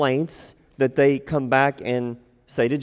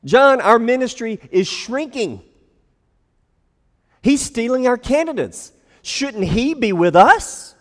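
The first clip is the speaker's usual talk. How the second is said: worried complaint; 9 semitones higher, 5 dB louder than usual. Acted. Disciples complaining about rival.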